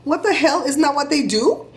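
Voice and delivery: as if that's the dumbest warning ever; incredulous. Retold or imitated. Telling neighbor her cat warning makes no sense, incredulous.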